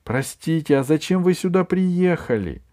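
high thin gnome voice; thin little voice. A narrator's reading. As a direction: pipe it up small and timid. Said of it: "тонкий голосок".

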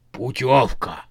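otherworldly zombie demand. A narrator's reading. Zombie foreman demands a trip from the witch. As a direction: say it like a dim undead bargainer.

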